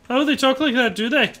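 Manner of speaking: put-on high, loud Liverpool accent; Scouse accent. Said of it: "The more I do that accent".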